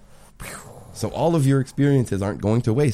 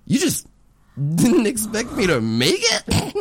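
incredulous; 12 semitones higher, slower than usual. Retold or imitated. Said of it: "And I was like".

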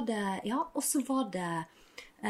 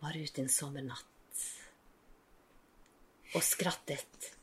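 mock poem recital; 5 semitones lower, 9 dB quieter than usual. Shown as a demonstration. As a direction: recite it like a solemn poem, quietly. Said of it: "hvis diktet begynner sånn". (stille, høytidelig diktlesning)

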